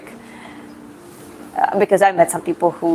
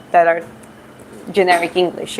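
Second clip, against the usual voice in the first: ordinary talk, nothing marked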